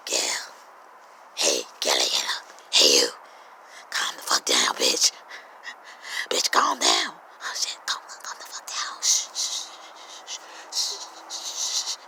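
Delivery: hushed whisper to calm yourself down; whispering to self. Retold or imitated. Talking yourself down from a fight in a whisper. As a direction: soothe yourself in a frantic whisper. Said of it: "You're like".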